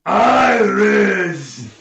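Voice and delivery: loud, high-pitched ogre bellow; ogre roar. Acted. Game master as ogre charging at player, roaring.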